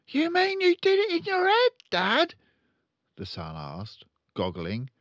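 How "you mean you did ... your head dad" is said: put-on high, loud little boy; young son voice. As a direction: squeak it like an eager son.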